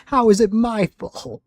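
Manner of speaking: shrill mock-indignant squeak; high squeaky protest.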